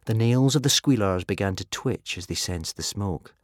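ordinary delivery

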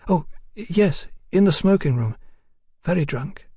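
high-pitched, halting woman's voice; startled woman. A narrator's reading. Woman startled into answering the Texian, nervous.

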